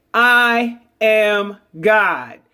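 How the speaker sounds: very slow, each word set apart; slow affirmation. Shown as a demonstration. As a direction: lay it down slowly like a creed. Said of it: "I want you guys to say".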